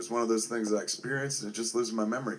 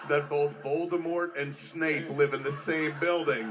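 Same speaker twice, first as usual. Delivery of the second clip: laughing; 3 semitones higher than usual. Real, not acted. Laughing while quoting Rickman's joke.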